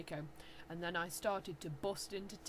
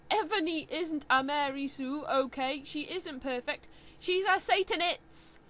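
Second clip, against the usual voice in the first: defensive author; 6 semitones higher, 7 dB louder than usual. Acted. Author defends her character.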